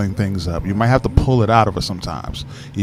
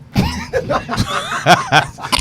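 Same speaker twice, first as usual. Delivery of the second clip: high laugh. Real, not acted. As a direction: let out a big high laugh. Loud laugh at answer.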